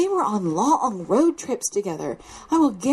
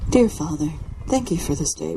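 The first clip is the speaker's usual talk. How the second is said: lower, solemn churchy prayer tone; 5 semitones lower than usual. Shown as a demonstration. Leading the family in prayer, lower solemn prayer voice. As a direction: intone it like a formal group prayer. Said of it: "our prayer voice".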